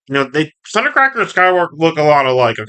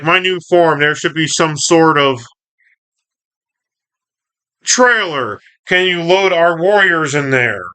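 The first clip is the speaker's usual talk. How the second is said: higher, lightly voiced leader; Optimus; 3 semitones higher than usual. Optimus talking of a new form, mildly hammed-up delivery. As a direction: lift the pitch, lightly acting a commander. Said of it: "Optimus like".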